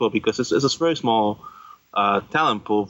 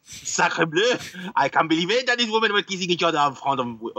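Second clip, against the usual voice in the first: high, mock outraged French voice; French accent; 8 semitones higher than usual. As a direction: play a scandalised Frenchman, hammed up.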